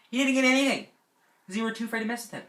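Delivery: high-pitched, loud outburst that then speeds up; indignant complaint. Real, not acted. Camper resenting the spared bishop's son, high indignant complaint. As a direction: protest the unfairness in a high voice.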